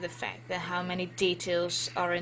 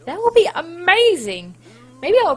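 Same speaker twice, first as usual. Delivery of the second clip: excited; 9 semitones higher, 13 dB louder than usual. Real, not acted. Excited about singing.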